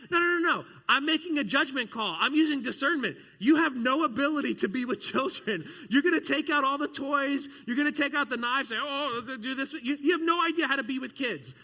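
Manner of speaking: high, loud, heated protest; exasperated rebuttal. Retold or imitated. Pastor loudly rebutting the students, comic exasperation. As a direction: argue back in comic exasperation.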